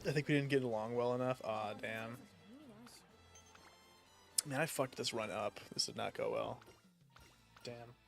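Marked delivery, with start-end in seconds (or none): none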